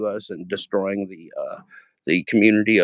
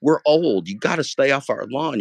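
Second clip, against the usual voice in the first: 1 mock grumpy old man; 7 semitones higher than usual.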